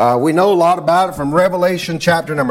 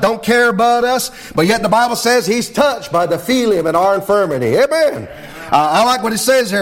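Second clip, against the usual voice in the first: higher, rising revival preaching; 4 semitones higher than usual. Acted. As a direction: build the fire, preach it out. Pastor builds into full preaching.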